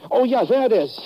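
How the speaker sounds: sudden high, loud burst of relief; excited find. Acted. Driver spotting the floating paper, excited. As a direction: pounce on it like you've found treasure.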